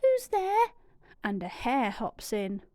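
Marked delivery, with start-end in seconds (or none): 0.0-0.7 high startled squeak; a tiny mouse calling into the dark; mouse crying out. Acted. Mouse calls to glowing eyes in den, high squeak. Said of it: "Mouse cries".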